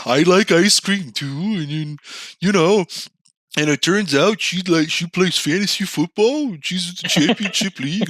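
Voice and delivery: mock starry-eyed excitement; hopeful inner voice. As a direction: gush like you've found the one. Continues eager inner monologue.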